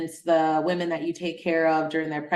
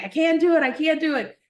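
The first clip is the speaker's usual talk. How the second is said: higher, louder panicky cry; frightened protest; 9 semitones higher, 4 dB louder than usual. Retold or imitated. Frozen atop the pole, crying out she can't. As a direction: plead from the top of the pole in fear. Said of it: "I was like".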